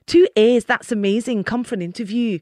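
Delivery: loud, delighted Scottish accent; amateur Scottish accent, enthusiastic. Shown as a demonstration. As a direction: put on a cheery Scottish accent down the phone. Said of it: "do your Scottish accent".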